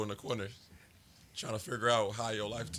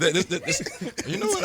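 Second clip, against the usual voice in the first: laughing; 9 semitones higher, 12 dB louder than usual. Real, not acted. Laughing while trying to speak.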